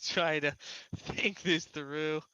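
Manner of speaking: ordinary talk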